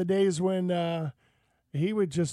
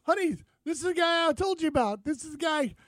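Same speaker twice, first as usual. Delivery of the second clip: excited fan; 9 semitones higher than usual. Retold or imitated. Fan voiced in retold story.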